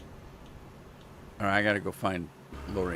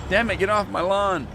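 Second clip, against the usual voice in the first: higher, louder get-off-my-lawn grump; cranky old man; 9 semitones higher, 4 dB louder than usual. Pretend. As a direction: bark it like an angry old neighbour. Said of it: "at least if I do that, people think I'm just joking".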